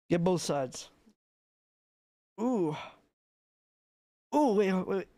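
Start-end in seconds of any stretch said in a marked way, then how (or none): none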